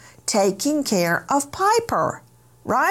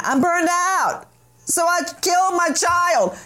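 mock whiny excuse; 8 semitones higher than usual. Mocking the defendant's excuse.

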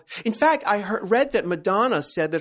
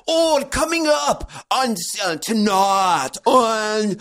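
hammed-up Geordie announcer, high and very drawn out; 4 semitones higher, 4 dB louder, slower than usual. Host mimics the Big Brother announcer, exaggerated Geordie accent. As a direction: imitate a Geordie TV voiceover, stretching every word. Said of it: "Even the announcers just".

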